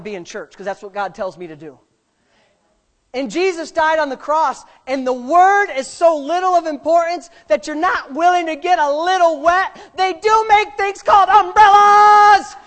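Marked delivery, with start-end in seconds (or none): 3.1-12.5 loud, high preacher's rebuke building to a shout; full-force preaching. Real, not acted. Pastor rebuking churchgoers afraid of getting wet, full-force preaching. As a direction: preach it hard, stretching out the last line.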